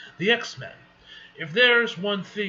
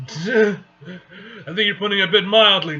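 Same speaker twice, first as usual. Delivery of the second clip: laughing; 4 semitones higher, 12 dB louder than usual. Acted. Reader cracks up while reading dialogue.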